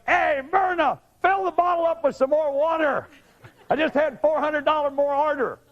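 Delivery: high-pitched, hammed-up huckster calling out; gleeful couch guy. Lecturer imagining a water seller crowing to Myrna, gleeful couch guy. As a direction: call out to Myrna in a high put-on voice. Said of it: "this fantasy of this guy sitting on his couch, laughing, going".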